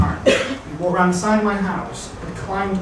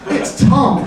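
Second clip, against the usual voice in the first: ordinary talk, nothing marked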